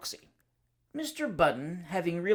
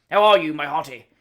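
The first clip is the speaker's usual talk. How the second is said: hearty greeting, much louder; top of his voice; 13 dB louder than usual. Acted. Cousin bursts in on the bachelor, booming at full voice. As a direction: boom the greeting across the room. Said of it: "He always spoke at the top of his voice".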